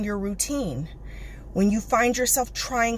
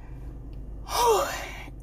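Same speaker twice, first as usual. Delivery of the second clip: heavy sigh; 12 semitones higher, 6 dB quieter than usual. Real, not acted. Just finished a workout.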